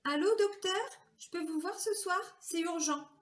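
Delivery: high, hurried plea down the phone; urgent phone call. Retold or imitated. She calls her doctor after the breakdown, high and urgent. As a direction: sound pressed and urgent.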